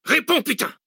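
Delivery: very high-pitched sudden scream; furious yell. A narrator's reading. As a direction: yell the demand at him. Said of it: "Cortès hurla".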